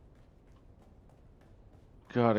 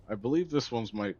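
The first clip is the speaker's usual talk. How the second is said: ordinary talk, nothing marked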